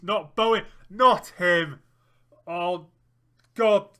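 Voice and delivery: loud dismayed yell at the screen; real groaning dread, rising to a shout; anguished shout. Real, not acted. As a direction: cry out as the striker breaks through. Dreading opponent striker scoring.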